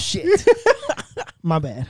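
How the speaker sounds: high laughing curse; a caught-out giggle; embarrassed laugh. Real, not acted. Caught cutting someone off.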